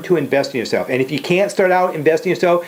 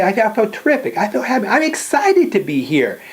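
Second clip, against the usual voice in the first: much higher, bursting with enthusiasm; enthusiastic; 8 semitones higher than usual. Retold or imitated. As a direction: sell it like you're thrilled to be there. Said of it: "how does that feel compared to the way you said it".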